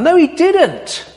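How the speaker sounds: high, slow emphatic denial. Real, not acted. Preacher correcting the snake for the church, high and emphatic. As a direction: stretch it out and lift it high.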